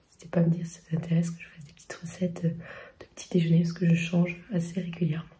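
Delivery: whispering. Real, not acted. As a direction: keep whispering, house asleep. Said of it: "Je parle tout bas".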